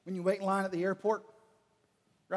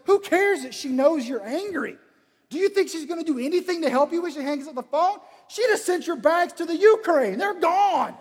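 high, loud fatherly rant; exasperated scolding; 9 semitones higher, 8 dB louder than usual. Acted. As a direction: hammer it home, loud and high, slowing at the end. Father scolds son in acted rant.

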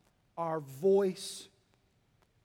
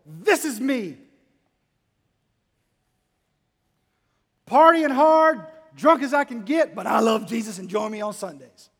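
much louder and higher, hammed-up party boast; mock party poster; 4 semitones higher, 13 dB louder than usual. Preacher mocks a churchgoer's party posts, hammed-up loud boast. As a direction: ham up a drunk reveller bragging online.

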